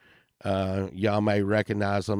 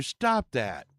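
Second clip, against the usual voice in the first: normal talking voice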